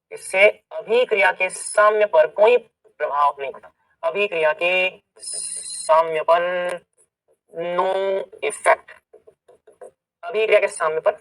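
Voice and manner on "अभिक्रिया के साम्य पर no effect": drawn-out words with pauses between; slow dictation. Real, not acted. Teacher writing out the rule for students, slow dictation. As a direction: dictate it slowly, a few words at a time.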